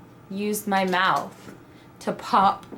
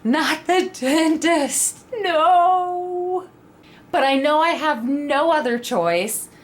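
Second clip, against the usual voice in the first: slow, higher, rising into a squeaky no; panicked whine; 6 semitones higher, slower than usual. Retold or imitated. Dreading a dental visit while pregnant, panicked whining protest. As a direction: plead it like a scared kid. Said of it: "And so now I'm panicking".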